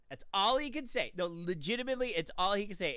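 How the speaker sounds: usual speaking voice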